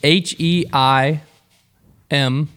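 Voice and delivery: slow letter by letter spelling; each letter spaced and deliberate. Acted.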